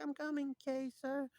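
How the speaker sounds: high, put-on boyish squire voice; obedient squire. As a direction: answer the master in a high little voice. Voices squire answering Kay.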